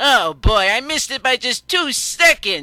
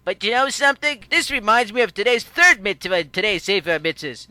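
ordinary delivery

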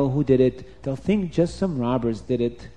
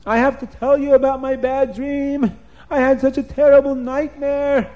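high, loud, urgent cries; Nimrod screaming; 11 semitones higher, 5 dB louder than usual. A narrator's reading. Nimrod to all the officers after his nightmare, screaming. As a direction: rouse everyone with a panicked yell. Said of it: "Nimrod is screaming to all the officers".

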